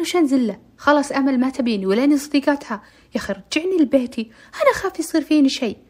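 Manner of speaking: put-on frightened girl begging loudly; scared pleading. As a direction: beg to be taken home, scared. Terrified girl pleads to go home.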